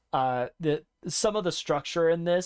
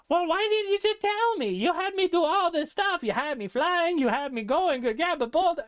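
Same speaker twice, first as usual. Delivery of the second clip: much higher, flustered outburst; a squeaky, exasperated hero who has been run ragged. Acted. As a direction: sputter it out, higher and higher with indignation. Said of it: "he gets so flustered by this".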